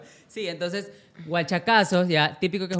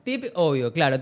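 ordinary delivery

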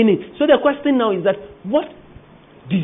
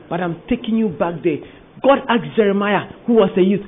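usual speaking voice

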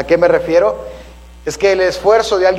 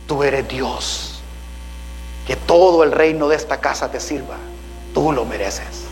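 heart's tempting voice; 5 semitones lower, 5 dB quieter than usual. Acted. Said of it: "mi corazón está gritando".

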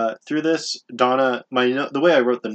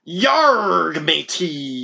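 hammed-up pirate growl-out, loud and higher; 5 semitones higher, 6 dB louder, much slower than usual. Cohost jokes about Pirates of the Caribbean, mock pirate. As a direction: give a big drawn-out pirate cry.

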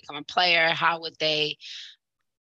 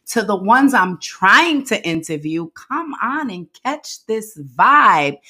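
upbeat host intro with punched key words; louder, animated show-opener delivery; podcast intro; 9 dB louder than usual. Real, not acted. Host welcoming listeners back for the anniversary episode, podcast intro.

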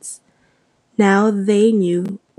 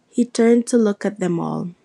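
ordinary delivery

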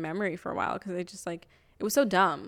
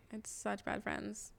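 much softer, slow, almost to herself; soft rueful echo; 2 semitones higher, 15 dB quieter than usual. Real, not acted. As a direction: let it fade out as a rueful afterthought. Repeats the line twice.

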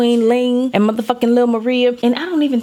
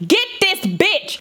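higher, slow, stretched-out demand; pointed demand; 10 semitones higher, much slower than usual. Retold or imitated. Telling the silent mother to get her child, slow and higher. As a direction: spell it out to the mother. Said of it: "tapped little non-speaking motherfucking mama".

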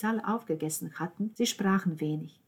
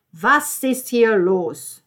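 very loud, slow, raised question; loud demand; 5 semitones higher, 14 dB louder, much slower than usual. Acted. Virna questions her son at the table, loudly demanding. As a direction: boom it out word by word.

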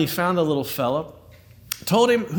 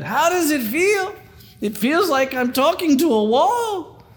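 put-on Yiddish accent; 9 semitones higher, 3 dB louder than usual. Old man's punchline.

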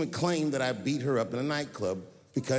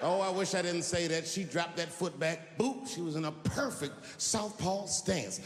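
ordinary delivery

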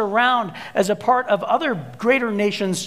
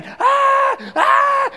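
high, loud ah ah screams; pained scream; 5 dB louder than usual. Retold or imitated. Stung by hornets in yard, screaming ah. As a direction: let out sharp stung yells. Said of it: "just screaming".

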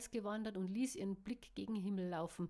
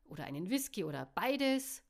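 calling out; 4 semitones higher, 10 dB louder than usual. Acted. Barkeeper calling to the room.

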